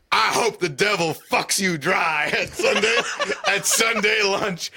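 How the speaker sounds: laughing through a quote. Retold or imitated. Retelling an insult at the family table, cracking up. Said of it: "Danny McBride's character says".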